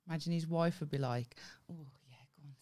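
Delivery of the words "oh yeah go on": barely audible, voiceless murmur; mock whisper. Imagining the surgeon's wife egging him on, mock whisper.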